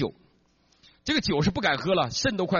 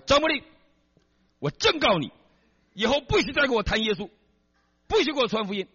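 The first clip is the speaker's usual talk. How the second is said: stern wife's warning; 8 semitones higher than usual. Retold or imitated. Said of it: "他老婆说".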